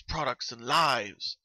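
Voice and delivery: usual speaking voice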